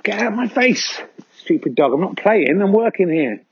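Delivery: high, irritated shoo-away voice; grumpy worker shooing a dog. Pretend. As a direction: brush off a pestering dog. Stone clearer shooing a dog away.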